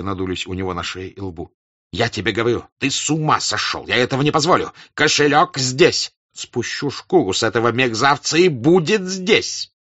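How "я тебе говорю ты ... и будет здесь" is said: loud, rising angry tirade; angry shout. Acted. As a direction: build into furious insistence. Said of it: "закричал он".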